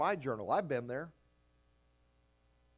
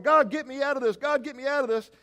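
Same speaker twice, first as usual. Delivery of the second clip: much higher, louder begging cry; desperate plea; 8 semitones higher, 8 dB louder than usual. Acted. Believer at the edge begging God, high loud plea. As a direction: cry out to God like a scared kid. Pleading prayer at pool edge.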